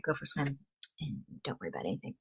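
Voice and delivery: ordinary delivery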